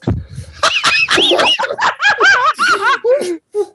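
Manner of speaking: shrieking laugh, loud and high; loud high cackle. Real, not acted. Narrator cracks up.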